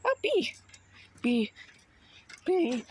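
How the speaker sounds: sudden high squeal at a bee; startled squeal. Real, not acted. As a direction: jump and squeak in fright.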